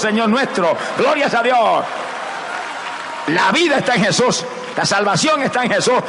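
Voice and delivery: high, driving sermon voice, pressing each line; fervent preaching. Acted. Preacher urging the congregation tonight to accept Christ, fervent preaching. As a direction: preach it home, calling sinners to Christ. Preaching salvation at full force.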